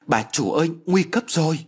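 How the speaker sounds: urgent call, rising high at the end. A narrator's reading. Maid calls the mistress at her door, urgent call. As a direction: raise the alarm, pitch climbing. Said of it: "tiếng gọi lớn của Sáu Thắm".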